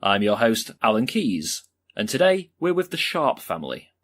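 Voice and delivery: punchy makeover-show introduction; TV show host. Acted. Host opening a home makeover show, showy presenting. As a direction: greet the viewers big and loud, then present the show.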